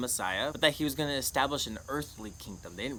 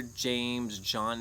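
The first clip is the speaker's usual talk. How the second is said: ordinary talk, nothing marked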